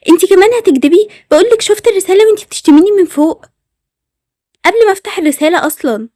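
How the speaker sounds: heated, accusing outburst; angry accusation. Acted. Acted quarrel, accusing friend of lying.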